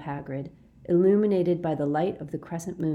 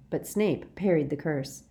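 ordinary delivery, nothing marked

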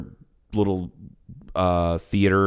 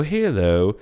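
put-on Southern accent. Dialect coach showing British turned Southern, Southern accent. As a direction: slide hello into a Southern accent. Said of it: "drops into a Southern accent".

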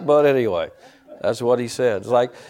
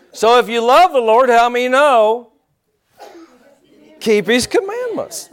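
high, ringing preacher's call; emphatic pulpit exhortation; full-force preaching; 9 semitones higher, 6 dB louder than usual. Acted. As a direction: drive the lesson home to the congregation.

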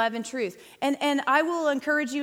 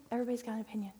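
repeated much softer, dropping away; quiet repeat; 2 semitones lower, 11 dB quieter, much faster than usual. Shown as a demonstration. Speaker to the women's ministry on online opinions, said softly. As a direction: let the line fall to almost nothing.

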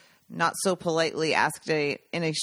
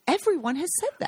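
higher, faster exasperated repeat of "Everyone has said that"; a comic exasperated echo, pitched up and rushed; 8 semitones higher, faster than usual. Shown as a demonstration. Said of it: "It's comic effect".

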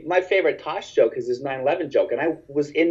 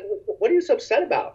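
confused; 8 semitones higher than usual. Retold or imitated. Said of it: "I was really confused".

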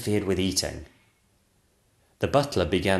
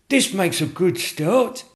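sculptor voice; 10 semitones higher, 4 dB louder, slower than usual. Acted. Sculptor speaking.

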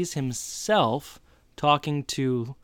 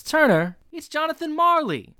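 much higher, louder reveal; spring the name on him, pitched way up; surprise reveal; 3 dB louder than usual. Acted. Jonathan reveals he's Marley.

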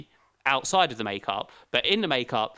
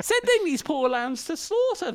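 much higher, shocked outburst with a laugh; horrified squeal; 13 semitones higher than usual. Retold or imitated. Recalling the film's sacrifice plan, horrified high squeal. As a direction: squeak out the outrage in a high put-on voice. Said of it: "I'm like".

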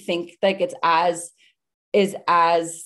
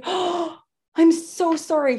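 high, drawn-out sympathetic apology; re-enacted gushing regret; retold mortified apology; 8 semitones higher, slower than usual. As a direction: imitate someone suddenly sorry, slow and high. Mortified passenger apologizes after outburst.

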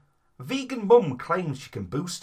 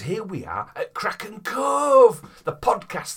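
announcer voice; 9 semitones higher, 8 dB louder, slower than usual. Acted. Podcast intro over music.

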